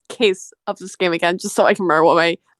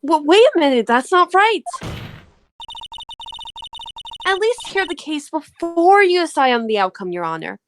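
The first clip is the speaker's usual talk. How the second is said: high-pitched courtroom objection; acted protest; 9 semitones higher than usual. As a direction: protest in a raised, higher voice, as a lawyer to the judge.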